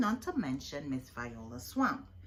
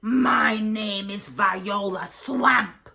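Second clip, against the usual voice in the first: slow, loud, menacing witch-teacher; stern substitute introducing herself slowly and loudly; scratchy voice; 2 semitones higher, 11 dB louder, slower than usual. A narrator's reading. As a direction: announce yourself to scare the class. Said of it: "said the lady in a scratchy voice".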